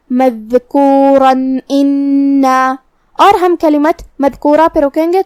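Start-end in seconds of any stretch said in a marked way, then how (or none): 0.1-2.7 مذکورا انا chanted slowly, tanween said as a clear n; clear nun without ghunna. Shown as a demonstration. Teacher demonstrating tanween before alif, slow chant with clear n. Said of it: "بغیر غنہ سے یہ حکم کو ادا کریں گے".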